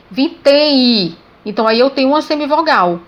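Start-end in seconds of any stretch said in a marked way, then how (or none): 0.1-1.2 VINTÉM I drawn out slowly, ending on a clear "ee"; final I sound stressed. Shown as a demonstration. Teacher showing M sounds as I, VINTÉM I slowly drawn out. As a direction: stretch VINTÉM so the M is heard as I. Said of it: "O som emitido por ele é o som I".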